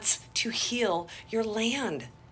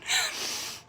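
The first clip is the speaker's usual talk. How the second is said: short high laugh; 6 dB quieter than usual. Real, not acted. Laugh after ocean joke.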